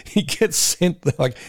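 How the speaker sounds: ordinary talk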